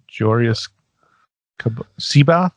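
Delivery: "Jorius" slowly as JOR-ee-us, "Seabath" as SEE-bath, questioning; uncertain attempted pronunciation. Shown as a demonstration. Co-host guessing how the audiobook says it, tentative pronunciation. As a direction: sound out "Jorius Cab-, Seabath" haltingly, rising at the end. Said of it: "Is that how they say it?".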